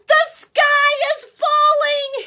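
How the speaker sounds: high loud frightened cry; scared cry. Acted. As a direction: alarm the whole farm. Chicken Little scared.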